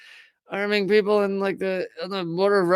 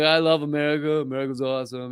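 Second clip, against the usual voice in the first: a lightly done Trump, lower; hint of a boastful Trump; Trump impression; 5 semitones lower, faster than usual. Mocking a Russia-hater cheering America, light Trump voice. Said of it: "talk in that Trump voice".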